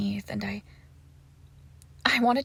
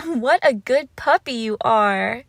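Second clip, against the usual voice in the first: slow, louder cooing with swooping pitch; gushing pet praise; 8 dB louder, slower than usual. Acted. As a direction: coo it as if praising a puppy.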